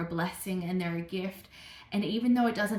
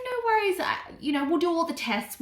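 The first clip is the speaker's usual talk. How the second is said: lightly voiced doctor, pitched higher; doctor's breezy reassurance; 8 semitones higher than usual. Doctor reassuring a patient about tests, higher-pitched mock breeziness.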